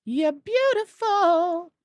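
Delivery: high, loud, very slow swoon; drawn-out adoring gush; adoring coo. Real, not acted. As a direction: swoon over it. Adoring an item.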